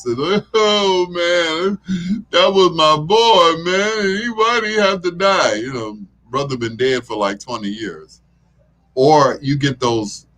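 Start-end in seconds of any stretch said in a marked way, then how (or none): 0.5-5.5 high, wailing mock drunk lament; crying drunk. Imitating a crying drunk grieving his brother, mock sob. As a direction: whine like a weepy drunk over a dead brother. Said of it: "those crying drunks".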